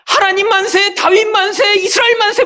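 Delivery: high-pitched rallying hurrahs, chanted in a row; acted triumphant cheer. Preacher voicing the crowd's hoped-for climax, triumphant cheering. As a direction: cheer like a jubilant crowd hailing God and king.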